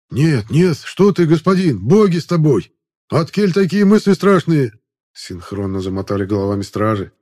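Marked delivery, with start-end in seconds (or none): 0.1-4.7 frightened guards. Acted.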